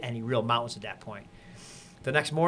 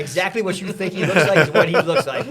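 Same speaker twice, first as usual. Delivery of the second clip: laughing; 5 semitones higher, 9 dB louder than usual. Real, not acted. Laughing while describing chief.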